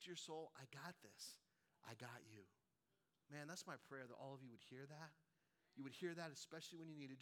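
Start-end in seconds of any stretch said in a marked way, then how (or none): 0.5-2.5 Spirit's gentle voice. Retold or imitated. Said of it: "Just the voice of the Spirit saying".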